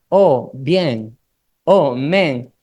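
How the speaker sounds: lightly voiced cousin, a hint of put-on New York slang; weird emigrant talk. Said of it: "Entonces, hablaba súper raro".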